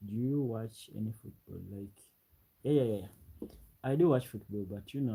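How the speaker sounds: ordinary talk, nothing marked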